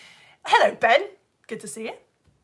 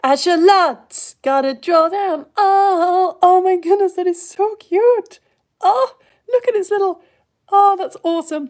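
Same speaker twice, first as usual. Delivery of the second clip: gushing delight; 6 semitones higher, 11 dB louder, slower than usual. Real, not acted. Gushing over the drawing.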